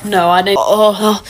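normal talking voice

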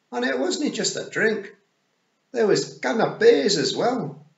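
high, loud Scots pictsie; Daft Willie. Acted. Daft Willie telling Tiffany about the party, high Scots voice. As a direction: boast cheerily in high, drawn-out Scots.

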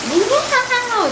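high mock wail; imitated baby cry. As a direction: imitate an angry baby's cry, pitched high. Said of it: "Ooh, he got so mad".

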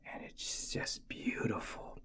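very soft breathy admiration; awed whisper. Real, not acted. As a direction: whisper it reverently. Awed hush.

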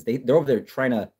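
ordinary delivery

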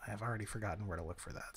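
hushed, quick whisper. Real, not acted. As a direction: whisper it to yourself. Unvoiced whisper.

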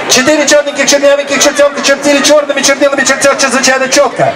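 quick, even rattle through the line; fast tongue twister. Shown as a demonstration. Teacher racing through a Russian tongue twister fast. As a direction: spit the words out quickly.

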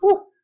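loud, sudden falsetto "woo"; high whoop. Real, not acted. Player reacts mid boss fight, loud high whoop.

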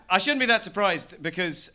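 ordinary talk

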